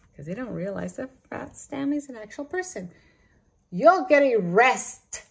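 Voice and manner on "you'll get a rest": slow, loud gloating shout. Acted. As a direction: shout it in triumph. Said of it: "shouted Mister Dart".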